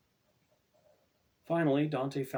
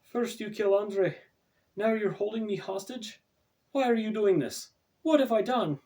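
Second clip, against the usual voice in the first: high, loud, pleading outburst; frightened demand; 12 semitones higher, 7 dB louder than usual. A narrator's reading. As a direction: hurl the questions at him, near hysteria. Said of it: "Stacy demanded".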